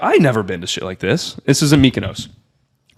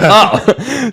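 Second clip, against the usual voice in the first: laughing; 10 semitones higher than usual. Retold or imitated. Laughing at his own naivety.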